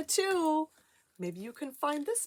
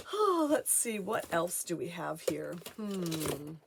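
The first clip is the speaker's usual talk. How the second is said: ordinary talk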